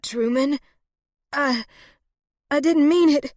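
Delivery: high, halting, distressed plea; pained moan. A narrator's reading. Wounded woman pleading with Truman, high halting moan.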